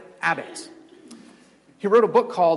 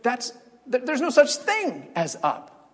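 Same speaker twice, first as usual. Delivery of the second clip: indignant creature voice; 7 semitones higher than usual. Retold or imitated. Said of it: "And he said".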